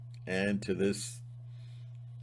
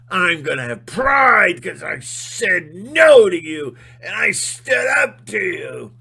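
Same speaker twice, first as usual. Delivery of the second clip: loud, much higher, building rage; furious defiant shouting; 8 semitones higher, 11 dB louder than usual. Retold or imitated. Timmy standing up to Rocky, furious shouting. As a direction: yell your defiance straight in his face.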